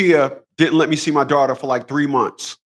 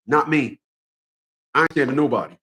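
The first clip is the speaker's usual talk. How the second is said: usual speaking voice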